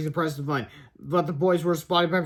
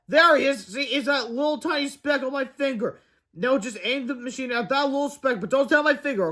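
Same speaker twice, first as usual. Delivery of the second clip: high character voice; 7 semitones higher than usual. Acted. Character voice in read story.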